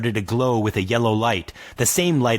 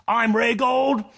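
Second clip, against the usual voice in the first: yelling; 12 semitones higher, slower than usual. Acted. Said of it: "yelled the dwarf".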